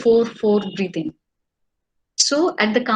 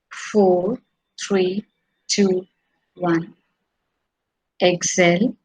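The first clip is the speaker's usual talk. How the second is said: calm breathing count, fading each number; counting guide; slower than usual. Acted.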